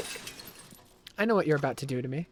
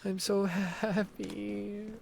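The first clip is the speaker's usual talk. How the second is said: sarcastic, slow; 4 semitones higher, 4 dB quieter, much slower than usual. Real, not acted. Sarcasm after bad news.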